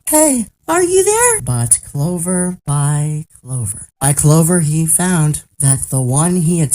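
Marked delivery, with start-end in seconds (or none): 0.1-1.4 high, searching call. A narrator's reading. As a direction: call hopefully for lost friends. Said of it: "searched and called".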